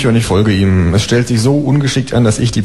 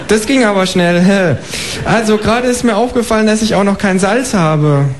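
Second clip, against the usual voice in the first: high, chirpy kangaroo asking for eggs; 11 semitones higher than usual. Acted. Kangaroo asking the neighbour for eggs, high and chirpy. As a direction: pipe up like a polite cheeky kangaroo.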